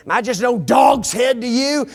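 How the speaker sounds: shouted, insulted question; offended shout. Acted. Abner to Ishbosheth, shouting in offense. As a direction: yell it at him, wounded pride.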